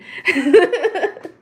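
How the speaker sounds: loud, high laugh at herself; giggling burst after the mock teacher act; high burst of laughter. Real, not acted. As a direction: break into a big laugh.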